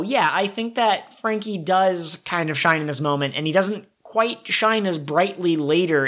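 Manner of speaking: normal talking voice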